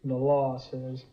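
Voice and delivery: low, dismissive echo; scornful echo. Acted. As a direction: throw the other man's words back, pitched low. Scornfully echoes the other man's words.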